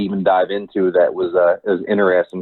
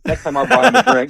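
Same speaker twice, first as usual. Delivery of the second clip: punchline broken by laughter; high giggle through the words; laughing. Real, not acted.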